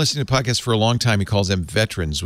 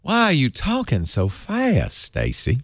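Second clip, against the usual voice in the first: put-on drawn-out Southern accent, higher pitched; mock Southern accent; 3 semitones higher, slower than usual. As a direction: tease with a slow Southern drawl. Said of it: "I'm from the South".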